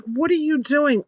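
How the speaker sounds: high-pitched incredulous question; retold disbelief, voice jumping up; imitated bewildered husband. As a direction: act out a baffled husband.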